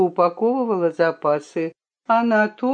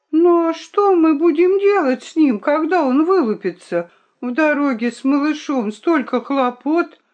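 high-pitched, fussing question; fretful worry; 8 semitones higher than usual. A narrator's reading. A companion worries about travelling with a chick, fretting. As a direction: fret aloud, pitch raised. Worrying over a hatching baby.

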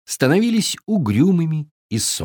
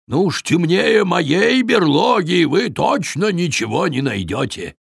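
grumbling bear; 6 semitones higher than usual. A narrator's reading. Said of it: "проворчал, подходя к ним, медведь".

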